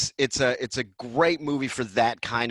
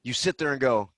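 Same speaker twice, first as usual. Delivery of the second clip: usual speaking voice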